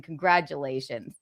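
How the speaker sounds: normal talking voice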